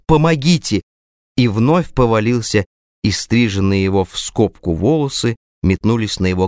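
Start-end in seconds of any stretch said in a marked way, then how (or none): 0.1-0.8 a father crying for help for his daughter. A narrator's reading. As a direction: call out desperately. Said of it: "потом крикнул".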